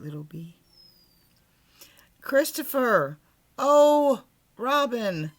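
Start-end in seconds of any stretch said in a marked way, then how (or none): 2.2-5.3 loud high call from above; loud, high calling of a small bear. Acted. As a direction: call out the boy's name across the sky. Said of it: "called out the cloud".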